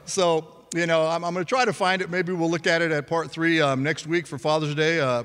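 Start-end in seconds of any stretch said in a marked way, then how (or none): none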